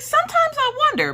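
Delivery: very high sing-out of doubt; mock wondering. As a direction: muse in an exaggerated squeaky voice. Acted joking doubt to husband.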